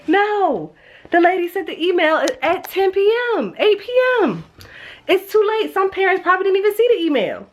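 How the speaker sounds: high-pitched, incredulous retort; exasperated protest. Retold or imitated. Mom telling daughter the email came too late, exasperated. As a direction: argue it back, voice pushed way up.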